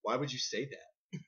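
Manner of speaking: low, quiet sincere question; subdued, puzzled aside; out of character. Retold or imitated. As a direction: drop the act and ask softly. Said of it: "he can't get into character".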